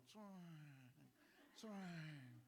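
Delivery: drawn-out falling swoosh; imitated car whoosh. Preacher mimicking cars arriving at the lobby, whooshing. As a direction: voice a car gliding past.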